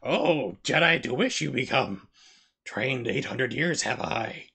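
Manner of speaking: slurred, slow Yoda, lines jumbled; drunk Yoda. Acted. Drunk Yoda mangling his own speech, slowly slurred. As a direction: play Yoda drunk and rambling. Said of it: "twenty minutes later".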